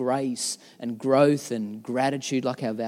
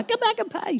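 attendant calling out; 3 dB quieter than usual. Retold or imitated. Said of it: "he's like".

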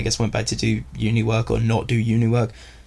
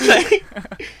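a sudden high laugh; laughing at his own mistake. Real, not acted.

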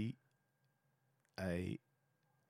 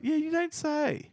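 high, drawn-out sarcasm; mock surprise; slower than usual. Co-host needles his partner about a tip, sarcastic mock surprise. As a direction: stretch it out in high-pitched fake amazement.

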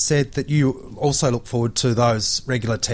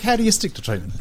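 ordinary talk, nothing marked

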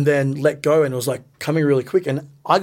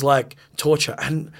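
usual speaking voice

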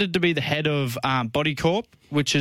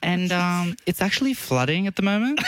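higher, put-on female caller; pitched-up pretend woman on the phone; girl's voice; 5 semitones higher than usual. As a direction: prank caller breaking news in a fake girly voice. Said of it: "I actually put a girl's voice on".

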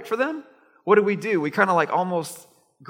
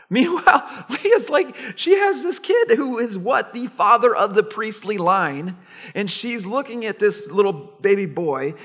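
excited; 5 semitones higher, 5 dB louder than usual. Real, not acted. Excited about Leah's blessing.

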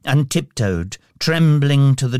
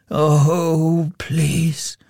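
anguished, drawn-out plea, higher-pitched; begging; 4 semitones higher, much slower than usual. A narrator's reading. Scrooge begs the spirit to stop, pleading. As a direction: plead slowly, breath giving out on please. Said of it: "he begged of the spirit".

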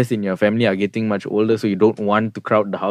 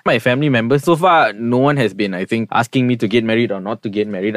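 normal talking voice

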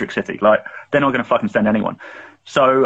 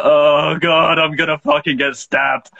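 higher, drawn-out mock dread; retold fear, stretched and raised; mock scared; 4 semitones higher, much slower than usual. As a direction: act out panicky inner voice, lightly. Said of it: "noticeably shaking".

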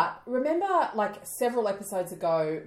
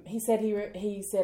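ordinary talk

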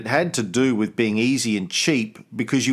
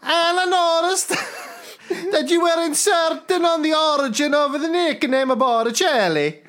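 bad Italian accent; 10 semitones higher than usual. Reading a listener's letter to the cohost in a mock Italian accent. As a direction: read the listener's email in a comic Italian accent. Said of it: "trying to think of what my Italian accent".

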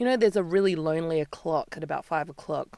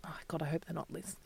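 hushed aside; 14 dB quieter, faster than usual. Retold or imitated. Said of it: "maybe a little bit".

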